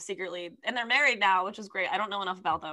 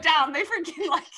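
laughing through the words; cracking up mid-sentence; 6 semitones higher, 7 dB louder than usual. Real, not acted. Laughing fit over the story.

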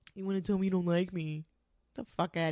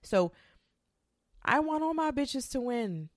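ordinary talk

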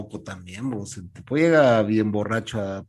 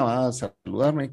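ordinary delivery

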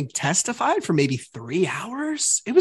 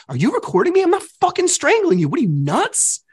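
higher-pitched mock exasperation; indignant outburst; 11 semitones higher than usual. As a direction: replay an angry denial, voice climbing. Re-enacting his angry argument.

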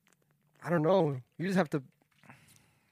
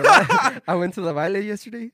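laughing; 4 semitones higher, 12 dB louder than usual. Real, not acted. Laughing while telling about party.

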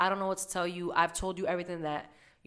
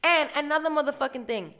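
fired-up warning; 7 semitones higher, 8 dB louder than usual. Real, not acted.

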